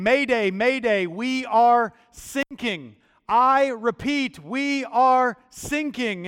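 loud, high acted Mayday, drawn out slowly; urgent radio distress call. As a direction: cry out a mock SOS, stretching each word. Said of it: "you hear a voice again".

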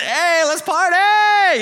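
high, loud, stretched-out party cheer; party shout. Retold or imitated. Healed man calling everyone to celebrate, loud high whoop. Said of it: "I mean".

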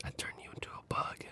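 creepy whispered threat. Pretend. Teasing threat to turn his cohost into a bug, whispered. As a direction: breathe it like a playful menace.

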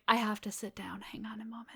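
hushed, breathless whisper; stunned whisper. Retold or imitated. Fan retelling reaction to Gina Davis comparison, stunned whisper. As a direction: whisper it as if floored.

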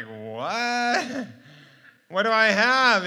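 high-pitched, stunned question; mock incredulity. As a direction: act out comic disbelief, voice jumping up. Retold shock at losing the walker.

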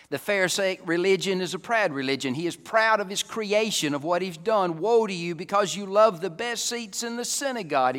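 loud, high-pitched preacher's proclamation; forceful preaching. Real, not acted. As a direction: ring the charge out to the congregation.

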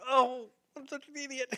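high-pitched mock dismay; mock self-reproach. Acts out self-reproach when God calls.

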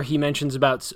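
ordinary delivery

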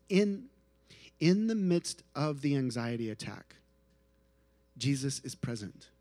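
ordinary talk, nothing marked

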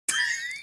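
very high squeaky mock cat screech; dead cat noise. Shown as a demonstration. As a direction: yowl like a dying cat. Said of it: "here's my impression of a dead cat".

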